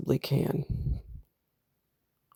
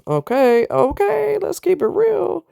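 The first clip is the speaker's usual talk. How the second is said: high excited okay. Real, not acted. Laughing at herself about bills.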